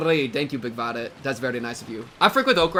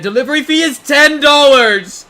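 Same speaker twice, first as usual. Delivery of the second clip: high, loud shocked outcry; 10 semitones higher, 14 dB louder than usual. Real, not acted. Streamer to chat, shocked at the fee, loud and high. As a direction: cry out in disbelief at the price.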